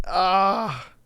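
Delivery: ordinary talk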